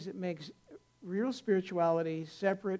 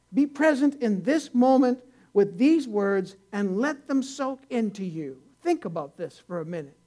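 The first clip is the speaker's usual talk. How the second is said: loud, slowed, lifted sermon delivery; earnest preaching; 3 semitones higher, 7 dB louder than usual. Acted. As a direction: lean on each word and let it land. Preacher urging stillness, drawn out.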